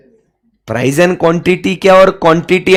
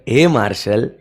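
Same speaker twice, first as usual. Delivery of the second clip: softer and lower, a fond call of the name; lovingly; 7 semitones lower, 7 dB quieter than usual. Shown as a demonstration. Teacher showing students how to call Marshall lovingly. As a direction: say it like a pet name, with affection. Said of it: "प्यार से बोलो".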